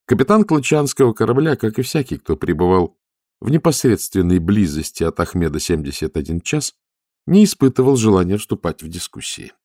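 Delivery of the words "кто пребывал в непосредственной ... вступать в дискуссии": usual speaking voice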